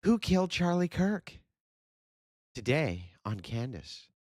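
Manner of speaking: high, dramatic then slow promo voice; mock show announcer. Parodying Candace's true-crime show, dramatic announcer.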